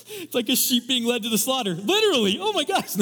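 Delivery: high, loud, laughing through the words. Real, not acted. Pastor cracking up at his own sheep joke, laughing. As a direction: giggle it out, can barely get it said.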